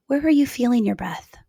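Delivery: ordinary talk, nothing marked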